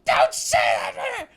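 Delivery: high, drawn-out imitated shriek; hammed-up scream, stretched out; retold scream. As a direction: screech it like an outraged great aunt. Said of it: "just screams".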